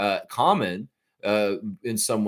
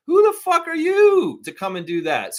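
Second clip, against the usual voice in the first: high, loud furious challenge; incredulous outburst; 4 dB louder than usual. Real, not acted. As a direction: demand who they think they are. Indignant rant at critics.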